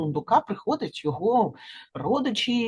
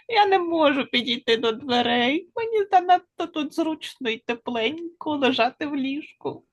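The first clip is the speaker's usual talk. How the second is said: higher little chipmunk; 7 semitones higher than usual. A narrator's reading. Chipmunk too snug to get up, high-pitched. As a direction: answer from bed, pitched up.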